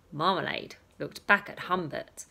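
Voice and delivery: usual speaking voice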